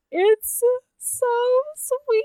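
high, squealed "so sweet"; gushing. Real, not acted. Host swooning at the couple's confession, high-pitched gush. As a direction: swoon over the romance.

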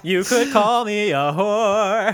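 slow, high, playful declaration; slow cheeky proclamation. Pretend. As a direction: announce it slowly and cheekily.

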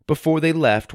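ordinary delivery, nothing marked

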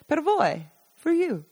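a hint of the old neighbour; lightly voiced Basilio. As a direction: hand over the gift kindly. Basilio offering gifts.

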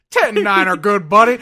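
loud trucker radio call; hammed-up CB trucker lingo; trucker CB talk. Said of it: "starts speaking in fucking, like, convoy".

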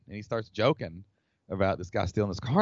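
ordinary delivery

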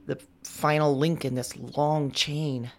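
ordinary talk

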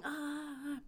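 soft, high-pitched imitated scream; a small held-back wail, high and quiet; mock scream. Retelling [name] screaming on the ground, soft mock scream. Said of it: "screaming and going".

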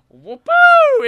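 silly high flying whoop; gleeful whoop. Acted. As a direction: whoop like a spirit shooting into the sky. Jesus flies away.

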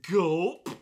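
nervous gulp. A narrator's reading. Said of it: "is all you manage to say".

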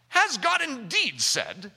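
slow, lightly put-on British accent; a hint of a British accent, drawn out slowly. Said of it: "Do you hear the British accent I gave to that one?".

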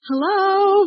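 high, singsong call to someone far off; acted-out friendly holler; retold hailing call. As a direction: hail a stranger across a field, high-pitched. Hailing the farmer across a field.

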